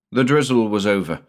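usual speaking voice

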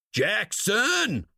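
high shouted name; screaming out. A narrator's reading. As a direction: bellow his name across the site. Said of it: "screamed out".

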